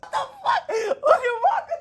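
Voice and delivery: very high, squeaky what the fuck; shocked. Real, not acted. Streamer shocked by the verse, very high pitched. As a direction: blurt it in disbelief.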